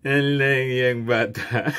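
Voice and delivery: slow, laughing through the words; can't stop laughing at the joke. Real, not acted. (natatawang sinasabi)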